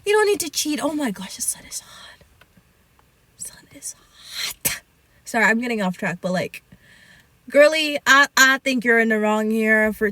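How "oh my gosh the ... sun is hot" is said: near-whispered, breathy aside; hushed aside. Real, not acted. Streamer distracted by the hot sun, hushed aside. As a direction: mutter it under your breath, barely voiced.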